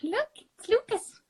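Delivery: high cooing to the cat. Real, not acted. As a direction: coo at the kitty. Showing cat to friend.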